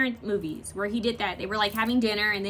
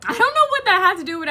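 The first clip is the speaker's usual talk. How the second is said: excited outburst; 10 semitones higher, 10 dB louder than usual. Real, not acted. Sudden outburst.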